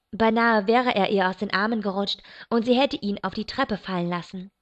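ordinary delivery

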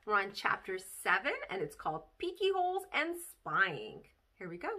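plain reading voice

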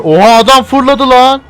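loud, high amazed cry; astonished shout. Real, not acted. Gamer shouting at a man flung through the air. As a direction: yell in disbelief.

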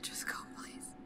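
whispering. Acted. Begging to be left alone.

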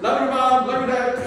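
high, loud, flippant throwaway; mock dismissive teen goodbye; mocking empty love you. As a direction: toss it off like a careless teen heading out. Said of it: "meaningless words, empty words".

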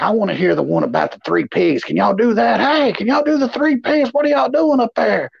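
put-on a nosy old lady demanding the three pigs; pushy old lady. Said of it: "I'd be like".

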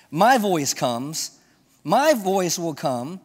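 "My voice comes" and "my voice will come" slow, with "my" punched; stress on my. Acted. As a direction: drag out "my voice will come", landing hard on "my". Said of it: "slow emphatic repetition".

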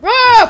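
high, very loud scream; short burst of play-acted screaming. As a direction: scream out suddenly. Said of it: "he's gonna go like".

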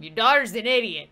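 quick, firm complaint at normal volume or above; brisk, voiced-up insult; emphatic repeat. Real, not acted. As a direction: say it out, fast and exasperated. Repeats the comment louder.

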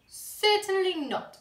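high, loud, slow mock-stern no; firm refusal. A narrator's reading. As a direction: stretch out a teasing refusal. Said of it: "said Missus Large".

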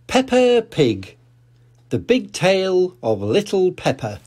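announcing the title. A narrator's reading. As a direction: present the book title with a flourish.

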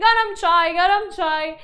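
mock hawker's cry, high and loud; high-pitched vendor shout. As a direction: shout your wares down the train. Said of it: "calling this in a high-pitched voice. He's shouting".

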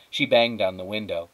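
usual speaking voice

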